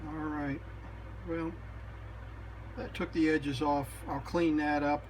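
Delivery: ordinary talk, nothing marked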